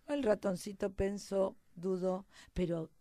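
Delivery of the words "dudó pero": ordinary delivery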